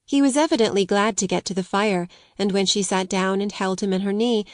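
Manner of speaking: ordinary delivery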